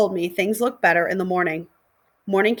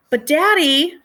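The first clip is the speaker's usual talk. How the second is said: high, louder tearful cry; crying plea; 8 semitones higher, 5 dB louder, slower than usual. A narrator's reading. Girl begging her daddy, high and tearful. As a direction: plead with her father through tears. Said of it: "she said, crying".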